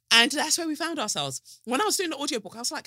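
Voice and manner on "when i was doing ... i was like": ordinary talk, nothing marked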